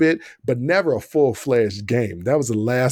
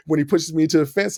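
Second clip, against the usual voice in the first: ordinary delivery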